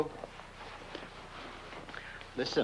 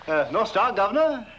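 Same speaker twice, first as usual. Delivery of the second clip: calling out; 7 semitones higher, 6 dB louder than usual. Acted. Calling out to the governor.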